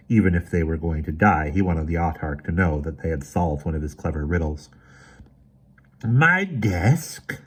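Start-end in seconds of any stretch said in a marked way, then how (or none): 6.0-7.4 grinning unpleasantly. A narrator's reading. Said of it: "grinning unpleasantly".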